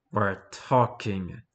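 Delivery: slow low menacing growl. Acted. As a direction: growl it slowly, full of menace. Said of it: "he growled at her".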